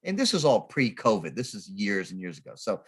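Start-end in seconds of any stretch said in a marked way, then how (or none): none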